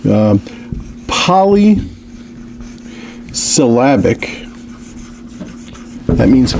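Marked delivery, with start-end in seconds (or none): 1.1-4.4 drawn-out word. Shown as a demonstration. Lecturer writing example on board.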